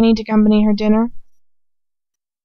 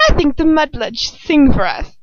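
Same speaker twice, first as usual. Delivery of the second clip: lightly voiced cruel witch; 6 semitones higher, 3 dB louder than usual. A narrator's reading. Bellatrix voiced by narrator.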